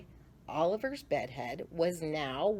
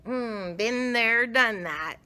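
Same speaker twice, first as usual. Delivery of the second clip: moaning; 6 semitones higher, 5 dB louder than usual. Acted. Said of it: "moaned Oliver".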